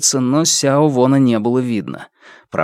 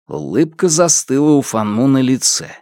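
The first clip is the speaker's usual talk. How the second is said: plain reading voice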